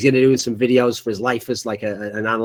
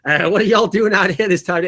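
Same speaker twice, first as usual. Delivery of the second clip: lightly raised mock Texas accent; a hint of a Texan housewife greeting guests; Texan wife; 5 semitones higher, 3 dB louder than usual. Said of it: "She's from Texas".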